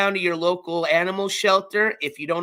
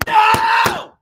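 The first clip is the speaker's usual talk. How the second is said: shout; 10 dB louder than usual. Real, not acted. Gavel nearly breaks on stream.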